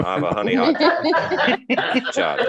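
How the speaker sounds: high, mocking sarcastic praise; sarcastic praise for dad. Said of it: "mocking dad".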